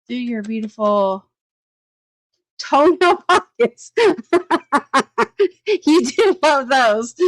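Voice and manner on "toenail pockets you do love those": high, loud words breaking into laughter; laughing. Real, not acted. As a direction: crack up at a silly typo as you speak. Misread comment sets her laughing.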